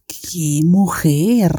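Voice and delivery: very slow, whispery awe; awed admiration. Real, not acted. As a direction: draw it out in a breath of admiration. Swooning over the actress. (susurro lento de admiración)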